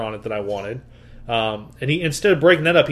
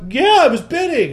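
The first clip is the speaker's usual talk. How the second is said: much higher, insistent; indignant agreement; 12 semitones higher, 4 dB louder than usual. Retold or imitated. Second dealer to partner, higher indignant insistence. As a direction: back up your buddy's outrage.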